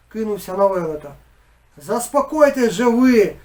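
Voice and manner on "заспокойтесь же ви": loud, slow bellow; even louder bellow. A narrator's reading. Village head bellowing over the fight, loud slow bellow. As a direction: drown everyone out. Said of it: "ще гучніше завалував".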